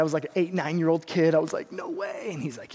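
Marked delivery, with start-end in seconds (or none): 1.7-2.3 put-on high, hushed disbelief of an excited kid; freaking-out kid. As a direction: play a kid softly freaking out. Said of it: "I was, like, freaking out".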